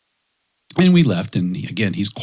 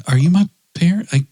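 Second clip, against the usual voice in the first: put-on worried kid; 6 semitones higher, 5 dB louder than usual. Son's confused questions retold.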